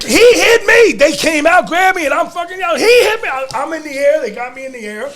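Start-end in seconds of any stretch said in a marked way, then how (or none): 0.0-0.9 high and loud desperate yell; protest cry. Retold or imitated. Wrestler crying out to officials, loud protest. 2.8-3.3 high, loud desperate yell; protest cry. Retold or imitated. Wrestler dragged out, crying out in protest.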